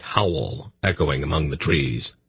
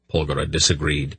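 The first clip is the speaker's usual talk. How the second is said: ordinary delivery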